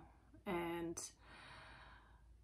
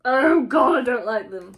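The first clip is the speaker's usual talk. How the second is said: much louder groaning recoil; disgusted outburst; 4 semitones higher, 14 dB louder than usual. Pretend. Listener recoiling from a possessive partner, loudly. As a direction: blurt out revulsion loudly.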